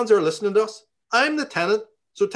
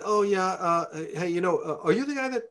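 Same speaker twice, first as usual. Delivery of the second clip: a softer, over-polite opening; real polite; 3 semitones higher, 4 dB quieter than usual. Retold or imitated. Tenant retelling how he politely approached the mailman. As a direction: sweet-talk him before the challenge. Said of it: "I was real polite".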